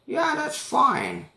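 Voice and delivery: put-on high, slow old lady voice; drawn-out old lady agreeing; high old woman impression.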